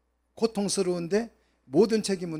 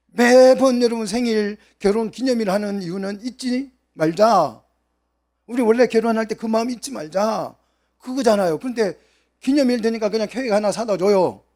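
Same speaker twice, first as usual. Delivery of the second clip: raised, louder preacher's voice; fervent preaching; 5 semitones higher, 5 dB louder than usual. Acted. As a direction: press the point home to the congregation. Preaching on anniversaries. (열정적인 설교)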